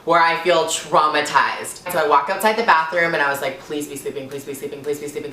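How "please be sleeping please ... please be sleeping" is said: very soft, low repeated wish; muttered, monotone begging; hushed pleading. Retold or imitated. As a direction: chant it under your breath like a prayer. Anxious silent wish outside bathroom.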